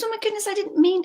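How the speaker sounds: a panicked auntie; flustered auntie. Retold or imitated. Auntie apologising to crying child, high and flustered. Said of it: "She goes".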